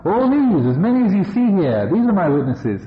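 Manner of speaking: lightly hammed-up proud demon king showing off his army; boastful Mara. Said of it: "Said Mara".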